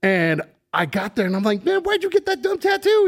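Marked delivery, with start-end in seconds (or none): 1.6-3.0 high, mocking jab; drunk teasing self. Drunk [name] mocking his coworker's tattoo, high and teasing. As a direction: needle your buddy about his tattoo.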